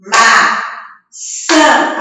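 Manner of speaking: "Maçã" in two open-mouthed beats, ma... çã; syllable by syllable. Shown as a demonstration. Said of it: "Abrindo a boca, falando".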